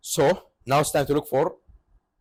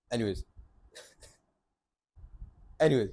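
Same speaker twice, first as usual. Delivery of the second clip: softer, low reset after yelling; regaining composure; 3 semitones lower, 5 dB quieter, much faster than usual. Real, not acted. Storyteller collecting himself after the rant, composed. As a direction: drop the rant and move on quietly.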